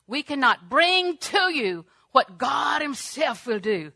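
loud, high, drawn-out proclamation; preacher's shout. Real, not acted. Preacher telling the congregation to wait upon God, shouting. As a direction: hit each phrase with full force, slowly.

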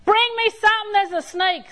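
high, loud holler for help. Retold or imitated. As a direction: yell for help across the house. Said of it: "So I hollered".

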